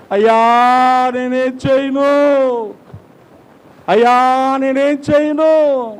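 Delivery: high, drawn-out anguished wail to God; cry from the heart. Acted. Preacher models a believer's helpless plea to God, crying out. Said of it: "హృదయపు లోపల నుంచి వచ్చే కేక".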